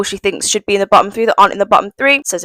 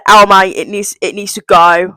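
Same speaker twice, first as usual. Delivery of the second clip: usual speaking voice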